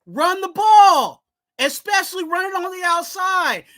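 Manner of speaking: frustrated fan telling the coach what to do; loud demand. Real, not acted. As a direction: bark the obvious answer at the coach. Demanding the run.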